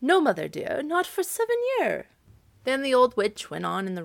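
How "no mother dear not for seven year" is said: high, polite cow voice, rising on seven; lightly voiced cow answering politely. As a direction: fib to the witch sweetly as the cow. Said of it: "she just said politely".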